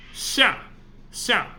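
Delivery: 下 said xià, falling sharply; Chinese pron demo. Shown as a demonstration. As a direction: model 下 clearly, mouth wide open. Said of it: "you kind of need to pay attention".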